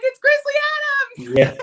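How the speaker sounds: very high, loud, drawn-out retold squeal; starstruck squeal. Guest retelling meeting a childhood star, excited squeal. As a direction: mimic your thrilled cry on spotting a star. Acts out her thrilled sighting.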